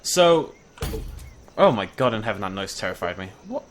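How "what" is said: ordinary talk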